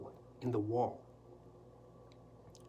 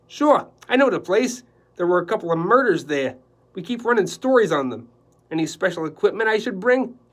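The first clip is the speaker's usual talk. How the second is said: a brash, cheery photographer, much higher and louder; 5 semitones higher, 12 dB louder than usual. Acted. Photographer calling Quillerin about the job, loud and brash. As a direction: boom it down the phone line like a pushy newsman. Bunsen continues.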